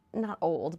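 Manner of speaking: ordinary delivery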